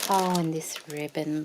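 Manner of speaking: ordinary talk, nothing marked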